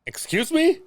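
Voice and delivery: can't believe he hung up; indignant disbelief. Retold or imitated.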